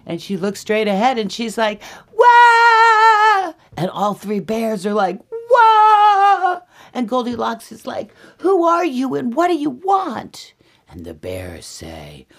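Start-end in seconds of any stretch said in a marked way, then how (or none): 2.1-3.5 very high, loud long scream; piercing girlish shriek; startled scream. Acted. Goldilocks screams at seeing bears. 5.5-6.6 high, loud drawn-out wail; piercing bears' shriek; answering scream. Acted. Three bears scream back. 8.4-10.5 very high, loud squealed demand; high-pitched startled girl; alarmed Goldilocks. Acted. Frightened Goldilocks challenges the bears.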